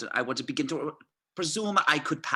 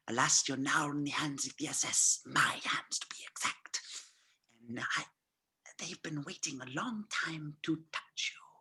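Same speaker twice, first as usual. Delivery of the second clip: menacing whisper; 5 dB quieter than usual. Acted. Landa taunting the captive Aldo, soft menacing whisper. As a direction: whisper it close to his ear.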